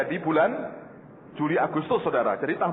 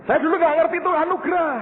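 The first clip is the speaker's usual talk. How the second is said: loud and high, driving the point home; loud raised preaching voice; emphatic preaching; 10 semitones higher, 6 dB louder, faster than usual. Real, not acted. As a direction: preach it out with force.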